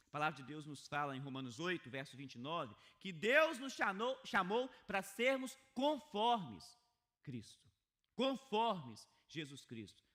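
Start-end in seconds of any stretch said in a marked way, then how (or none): 3.0-10.0 loud, slow, driving sermon delivery; forceful preaching. Acted. Pastor urging the congregation, loud and slow. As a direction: preach it out to the whole church.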